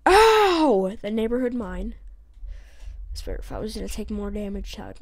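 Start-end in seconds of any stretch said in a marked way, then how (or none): 0.1-1.0 high, loud "ow"; pained yelp. Real, not acted. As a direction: yelp as if suddenly hurt. Takes damage in game.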